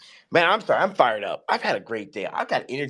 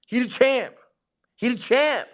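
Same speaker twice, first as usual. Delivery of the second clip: hyped praise; 13 semitones higher, 5 dB louder than usual. Real, not acted. Hyping the poker champ.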